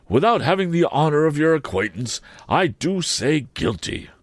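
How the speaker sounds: high, pompous Mr Wopsle voice; fussy pompous man. A narrator's reading. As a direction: raise the pitch, puff it up.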